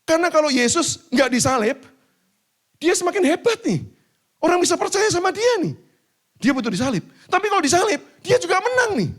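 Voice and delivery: loud, high preaching voice. Acted. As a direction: preach it out over the crowd. Preaching at full force. (khotbah lantang dan tinggi)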